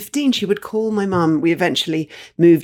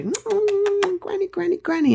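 tender greeting. Retold or imitated. Granddaughter kissing frail granny hello, high and tender. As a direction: gently coax her attention, much higher.

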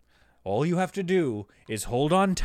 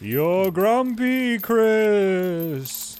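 spooky ghost; 4 semitones higher, much slower than usual. Acted.

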